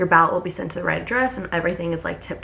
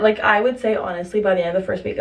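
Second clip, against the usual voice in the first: ordinary talk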